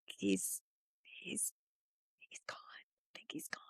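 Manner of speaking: barely audible whisper, halting; very soft, broken into small whispered pieces; hushed realization. Retold or imitated. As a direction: whisper it haltingly, taking in a death. Retelling realizing he has died.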